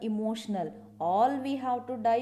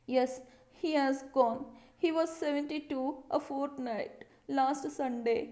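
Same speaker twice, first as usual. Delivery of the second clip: fussy, sniffing tone, a bit higher; a slightly higher, sniffy, fussed-over voice; sniffy and bothered; 4 semitones higher than usual. Shown as a demonstration. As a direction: read it like she's sniffing and put out. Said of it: "Like that she said with full of bother".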